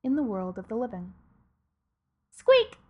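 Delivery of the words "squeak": high squeaky rat voice; rat squeak. Acted. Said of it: "said the death of rats".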